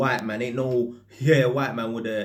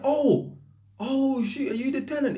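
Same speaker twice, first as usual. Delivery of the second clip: much higher, caught off guard; surprised. Retold or imitated. Electrician realises the man at the window is his tenant, startled. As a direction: squeak it out in startled disbelief.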